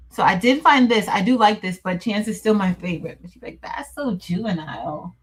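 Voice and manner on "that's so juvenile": a light, low-key imitation of her friend's dismissive tone; mild mock of a friend's verdict; mimicking best friend. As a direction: say it just the way she does, unimpressed. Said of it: "I'm saying exactly how she says it".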